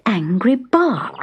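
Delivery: ordinary delivery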